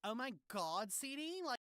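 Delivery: put-on high, soft gossipy girl; gossip voice. Shown as a demonstration. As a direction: gush like a gossiping teen. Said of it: "Got the gossip voice down so well".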